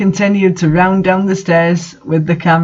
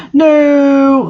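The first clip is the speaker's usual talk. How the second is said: loud high cry of protest, much higher; shouted; 8 semitones higher, 4 dB louder than usual. A narrator's reading. Lupin being chained, a loud high shout. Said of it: "Lupin shouted".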